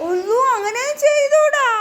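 high, stretched-out dismayed telling-off. Real, not acted. Repeats the warning, more drawn out.

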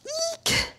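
tiny high squeak of effort; high strained squeak. Real, not acted. Coach straining through the hold, squeaking. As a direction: let a squeak escape under the load.

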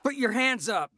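sudden high, loud barked command; shouted order. Acted. Young gunman ordering police to raise hands, shouting. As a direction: yell it at the cops to freeze them. Gunman surprising two cops.

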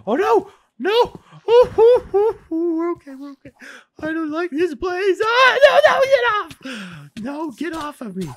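high, loud, fearful crying out; panicked screaming. Real, not acted. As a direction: scream for it to get off. A mob attacks her.

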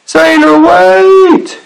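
very high, long-held call; cried out. A narrator's reading. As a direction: call desperately across the sea. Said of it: "he cried".

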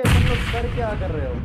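loud, fed-up shout at teammates; exasperated yell. Real, not acted. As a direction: yell at the squad in frustration. Yells at team in firefight.

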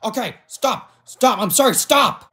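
high, insistent begging; desperate pleading. Acted. King begs his double to stop fighting, pleading.

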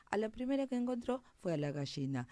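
ordinary delivery, nothing marked

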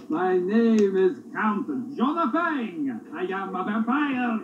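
low, slow, would-be Count; hammy fake vampire, a touch deep; bad vampire voice. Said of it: "Talk like a vampire".

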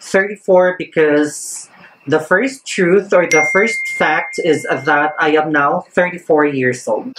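ordinary delivery

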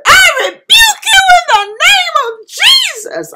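tearful shout driving the demon away; desperate scream. Retold or imitated. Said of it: "I was screaming at that point".

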